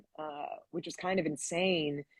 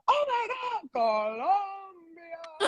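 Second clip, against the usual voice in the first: high, drawn-out joyful cry; excited crowd cheer; 10 semitones higher, much slower than usual. Retold or imitated. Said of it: "everyone goes".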